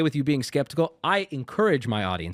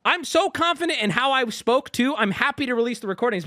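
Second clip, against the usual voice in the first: mock smug caller; 11 semitones higher, 4 dB louder than usual. Mocking caller's smug self-defense.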